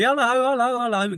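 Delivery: high, sing-song hellos to everyone; cheery greeting. Pretend. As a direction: greet the whole room gushingly. Acting Santa greeting a crowded room.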